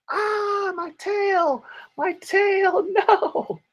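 high squealing hurt wolf; wolf in pain. Acted. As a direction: cry out as the wolf whose tail was stepped on. Wolf's tail stepped on.

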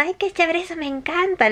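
a high, delighted exclamation. Retold or imitated. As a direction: gush with joy at the salsa.

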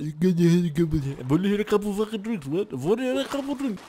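put-on high, garbled drunken mumbling; drunk slur. Player demonstrating a drunk act, high slurred garble. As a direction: garble it with a lazy tongue, pitch climbing. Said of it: "You gotta slur a little bit".

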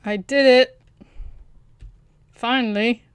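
loud burst of triumph, easing slightly; triumphant. Real, not acted. Player solving it on their own at last, triumphant. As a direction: crow with relief at success.

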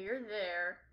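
normal talking voice

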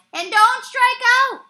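high, loud insistent shout. A narrator's reading. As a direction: bellow it, stressing don't. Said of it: "shouted McCreary".

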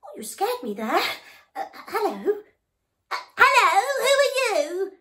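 high, loud nervous voice; startled little elephant. Acted. Scared elephant answering the noisy frog. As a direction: stammer it out, still shaken.

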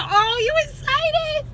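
put-on high, slow, delighted question to a child; light mock surprise for her son; high excited reply. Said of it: "And I said".

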